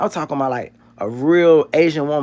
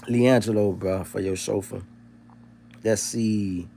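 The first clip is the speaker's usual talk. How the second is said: usual speaking voice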